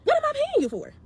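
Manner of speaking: retold high, loud indignant retort to the stylist; incredulous retort. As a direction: fling the question back at him. Confronting stylist over payment.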